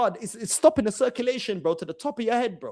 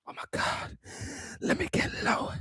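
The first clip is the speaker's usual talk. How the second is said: soft, unvoiced whisper; hushed mocking imitation; 8 semitones higher, 10 dB quieter than usual. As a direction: whisper it like a parody rap intro. Mimics the whisper song again.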